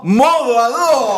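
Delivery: slow, drawn-out announcer voice; dramatic announcer. Acted. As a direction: stretch out the show slogan.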